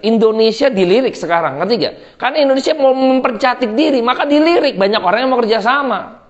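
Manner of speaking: ordinary talk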